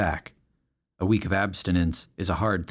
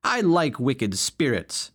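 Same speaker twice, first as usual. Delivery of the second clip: defiant retort; 6 semitones higher, slower than usual. Acted. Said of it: "Snarf shot back".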